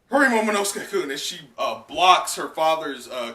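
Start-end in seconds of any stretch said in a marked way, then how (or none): 0.1-1.1 fast, higher, louder hurry; urgent Yamato. Acted. Yamato urging Momo while blocking Kaido, quick shout. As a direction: rush him along. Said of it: "Yamato goes".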